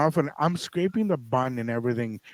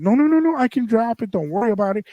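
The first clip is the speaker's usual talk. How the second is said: high, eager no no no, pitched up; eager insisting; 8 semitones higher, 4 dB louder than usual. Retold or imitated. Landlord insisting on dropping off keys, pitched-up eager protest. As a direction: push back brightly, won't take no.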